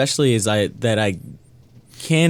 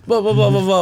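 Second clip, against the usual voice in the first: cocky boast; 11 semitones higher, 4 dB louder than usual. Retold or imitated. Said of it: "I was like, I'm like".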